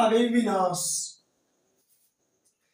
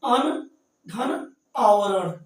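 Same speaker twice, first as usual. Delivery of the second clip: अन धन आवरण spelled out slowly, part by part; slow breakdown; much slower than usual. Shown as a demonstration. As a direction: split अनावरण into its parts slowly. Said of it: "जैसे अनावरण".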